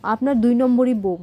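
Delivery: plain reading voice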